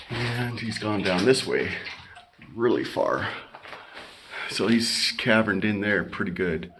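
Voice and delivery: ordinary delivery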